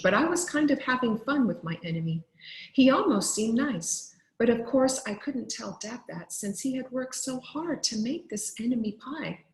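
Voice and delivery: usual speaking voice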